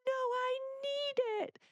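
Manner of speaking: put-on high, slow, stretched child's protest; whiny child. Child refusing to give up the cup, high drawn-out whine. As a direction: wail it like an upset little kid. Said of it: "If they're like".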